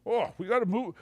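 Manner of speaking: lightly voiced Rodney calling out to his wife; Rodney confused about the giant shoe; mock bewildered Rodney. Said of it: "and he goes".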